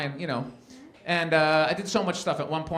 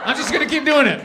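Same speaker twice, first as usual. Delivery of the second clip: defiant punchline; 8 semitones higher, 6 dB louder than usual. Retold or imitated.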